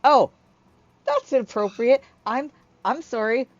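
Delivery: high, loud, flustered character voice; excitable Hyla apologizing. Acted. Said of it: "she's so happy, and then she's like".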